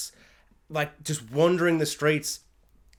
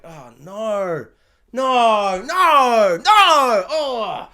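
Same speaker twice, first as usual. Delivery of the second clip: rising alarmed cries of no, getting louder; mock horror building up; panicked denial swelling; dismayed no no no; 4 semitones higher, 6 dB louder, much slower than usual. Dismayed at seeing the crib.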